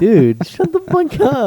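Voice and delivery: very high, slow, loud mock yell; retold exasperated yell. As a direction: drag it out like an exasperated comeback. Retold comeback to classmate.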